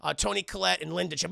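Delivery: usual speaking voice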